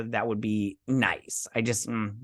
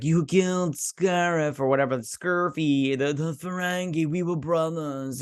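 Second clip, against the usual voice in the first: imitated character voice; 3 semitones higher than usual. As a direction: put on his odd voice, dragging the words. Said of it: "I don't like the voice he did".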